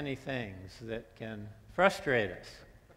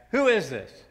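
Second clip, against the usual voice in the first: higher, louder annoyed snap; irritated caller; 8 semitones higher, 11 dB louder than usual. Acted. Stranger answering the repeat call, higher and irritated. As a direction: bark it like a bothered stranger.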